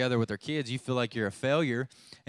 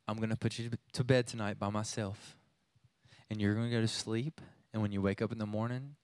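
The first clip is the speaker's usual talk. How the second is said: tender soothing; 3 semitones lower, 5 dB quieter than usual. Retold or imitated. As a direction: hush her to sleep gently. Father soothing crying daughter.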